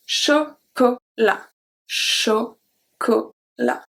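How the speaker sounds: Chocolat very slowly, with hard open O; slow model word. Shown as a demonstration. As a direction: drag out Chocolat for learners. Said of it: "difference between a soft O and a hard O".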